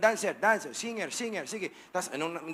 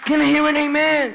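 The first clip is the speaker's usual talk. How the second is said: loud, high preacher's call; calling out to crowd; 8 semitones higher, 13 dB louder than usual. Real, not acted. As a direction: rouse the congregation. Calling congregation response. (sigaw sa kongregasyon)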